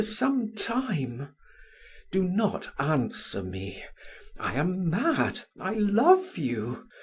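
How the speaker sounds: passionate. A narrator's reading. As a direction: pour out a mad love, rising and pressing. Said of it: "repeated in a passionate voice".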